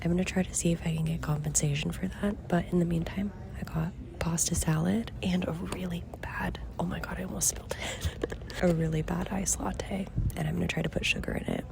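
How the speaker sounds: soft whisper into the phone. Real, not acted. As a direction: whisper so nobody around hears. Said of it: "I'm trying to whisper into my phone".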